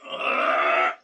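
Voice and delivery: very low, long groan; low stretching groan. Real, not acted. Man stretching in his workout, low drawn-out groan. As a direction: groan deep as you stretch.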